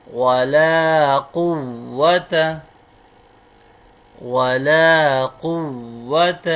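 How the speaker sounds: slow, drawn-out Arabic recitation, word by word. Shown as a demonstration. Teacher reciting the kalima slowly for students to follow. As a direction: chant each word long for the class to repeat.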